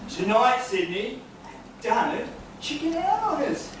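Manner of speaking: high mocking laugh trailing off; gloating cackle. Son gloats alone over the turkey plot with a cackle. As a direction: cackle like a triumphant villain.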